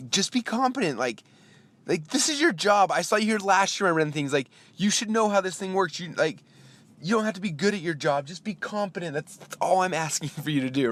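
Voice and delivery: high-pitched exasperated pleading; angry inner rant. Retold or imitated. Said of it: "angrily staring at this guy".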